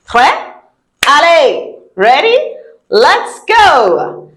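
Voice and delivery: excited go. Real, not acted. Rallying class to start quiz.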